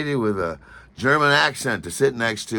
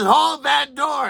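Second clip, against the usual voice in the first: slow, high, loud call; a drawn-out high shout down the corridor; 5 dB louder, slower than usual. Retold or imitated. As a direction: call down the hall to hold the door. Airport woman calling down the hallway.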